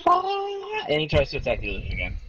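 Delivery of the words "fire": goblin yelling. Acted. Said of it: "and he's like".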